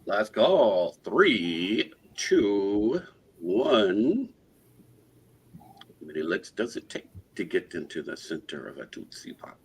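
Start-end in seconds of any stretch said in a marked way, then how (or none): 6.0-9.6 whispering. Real, not acted. Whispered aside during the auction.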